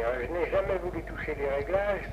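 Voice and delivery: ordinary delivery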